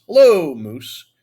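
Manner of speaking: loud, drawn-out hammed-up greeting; booming, slow show of charm; putting on charm. A narrator's reading. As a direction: stretch the hello out big and slow. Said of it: "I say in my most charming voice".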